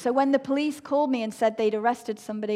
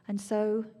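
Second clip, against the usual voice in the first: usual speaking voice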